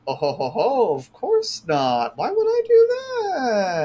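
high, slow, sing-song mock innocence; mock innocent lady. She waves off the accusation, mock innocent and high. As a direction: play it coy and high-pitched. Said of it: "She's like".

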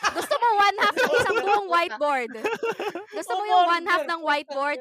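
loud laughing teacher scold; mock scolding. Teacher retelling mocking a student, loud laughing scold.

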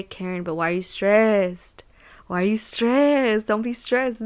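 lightly voiced mentor, gently asking why she's stressed; a hint of the calm mentor's soothing question; imitating mentor. Mentor soothing stressed Karen at work, mildly imitated. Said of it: "That's literally how he talks".

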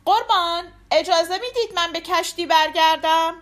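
high, loud, projected request; loud announcement. A narrator's reading. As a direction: call it out so everyone around can hear. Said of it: "با صدای بلند، طوری که همه بشنون".

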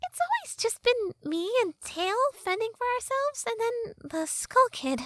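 high-pitched sassy fairy; Tattle fairy voice. Acted. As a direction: play a cheeky fairy girl, high.

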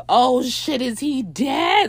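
high, drawn-out mock panic; alarmed. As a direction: imitate friends freaking out. Friends find him seemingly dead.

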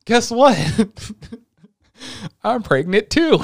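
spring it like a gleeful surprise; mock maid announcement. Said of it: "And the maid's like".

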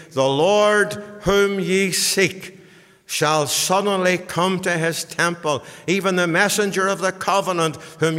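loud, ringing Bible reading; declaimed scripture. Real, not acted. Preacher reading Malachi's prophecy to the congregation, forceful declamation. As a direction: proclaim the prophecy from the pulpit.

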